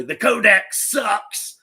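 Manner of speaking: mock heckle. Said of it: "you hear someone say".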